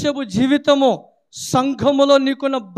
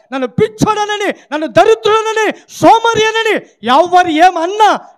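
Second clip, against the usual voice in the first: higher, louder, defiant preaching cry; defiant shout; 6 semitones higher, 5 dB louder than usual. Real, not acted. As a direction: shout it out against the mockers. (గట్టిగా ధిక్కారంగా అరుపు)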